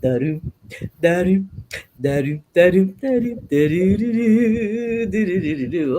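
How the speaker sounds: sung-out "da-doo" sneaking noises, building to a run; playful suspense sound effect; creeping-cat motif. Acted. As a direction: mimic stealthy footsteps gathering speed.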